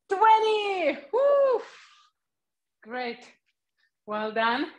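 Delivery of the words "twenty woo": rising to a high whoop; excited cheer. Real, not acted. Finishing the set, cheering.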